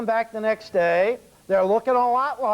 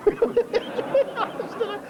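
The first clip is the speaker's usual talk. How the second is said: high, laughing mock echo of the line; giggling mockery of the manager's excuse; 13 semitones higher than usual. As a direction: repeat it through a laugh, mocking.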